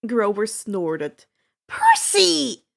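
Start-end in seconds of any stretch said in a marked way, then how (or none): 1.7-2.7 higher and louder, half-amused protest; Annabeth crying out. A narrator's reading. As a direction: call his name in mock outrage. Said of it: "Annabeth cried".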